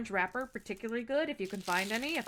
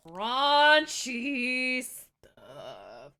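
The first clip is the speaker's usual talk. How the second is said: drawn out; 8 dB louder, much slower than usual. Real, not acted. Ashamed of raunchy content.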